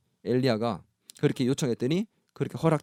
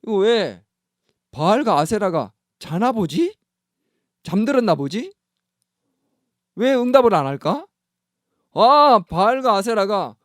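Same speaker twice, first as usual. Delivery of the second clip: higher, louder, teasing sneer at the silent gods; mocking taunt; 6 semitones higher, 4 dB louder than usual. Elijah taunting Baal's prophets, mockingly. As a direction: needle them, drawing it out. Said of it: "조롱을 했어".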